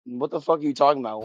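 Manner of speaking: ordinary talk, nothing marked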